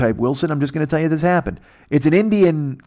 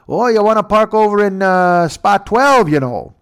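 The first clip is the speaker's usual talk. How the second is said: put-on Canadian accent, high and loud, twelve dragged out slowly; hammed-up Canadian, stretching spot twelve; mock Canadian drawl; 8 semitones higher, 6 dB louder than usual. Canadian parking attendant mocked.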